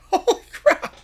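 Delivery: high, loud, laughing shout; laughing disbelief. Real, not acted. Fan laughing in amazement at the player.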